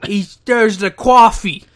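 put-on loud, slow, overdone New York accent; mock New York accent. Cohost mocks the wrestler's promo, hammed-up New York accent. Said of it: "a New York accent".

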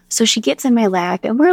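ordinary talk, nothing marked

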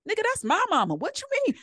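high, disbelieving squeal; can't believe what mama just said; incredulous outburst. Retold or imitated. Daughter reacting to her mother's funeral joke, high disbelief.

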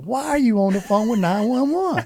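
high, incredulous protest; retold exasperated disbelief. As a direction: question it as utterly absurd. Appalled at wife calling emergency line.

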